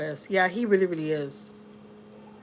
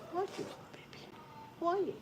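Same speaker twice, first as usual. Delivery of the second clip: whisper; 9 semitones higher, 12 dB quieter than usual. Real, not acted. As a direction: breathe it softly to the dog. Soft aside to the dog.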